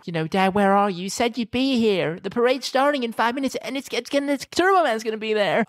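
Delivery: put-on high-pitched little boy pleading; kid Jamie voice. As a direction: whine like a kid who wants his dad home. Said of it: "starts off going".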